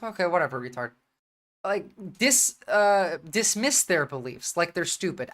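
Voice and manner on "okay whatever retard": calm dismissive. Shown as a demonstration.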